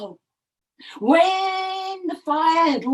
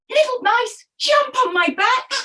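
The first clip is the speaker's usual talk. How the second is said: high calling voice, urgent; 9 semitones higher than usual. Acted. Parrot calling the mice down below, high urgent call. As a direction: call down to the mice to climb aboard.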